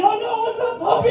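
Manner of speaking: same hello, but squeaking as the dog lunges; panicked puppy greeting. Retold or imitated. Dog attacking him under the truck, squeaky panicked greeting.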